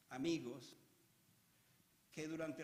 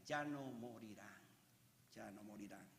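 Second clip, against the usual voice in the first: hushed solemn promise; 10 semitones lower, 10 dB quieter than usual. Real, not acted. Preacher repeating the promise of no more death, hushed. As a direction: let it sink low, almost to nothing.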